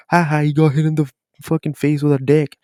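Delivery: mock the viewers teasing him. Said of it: "I know what y'all are gonna say".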